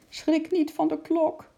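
high, loud warning call; desperate shout. Acted. Lotje shouts up to Sjimp to warn him, loud and high. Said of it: "riep ze uit alle macht".

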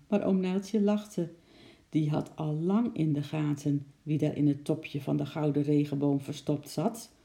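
ordinary delivery

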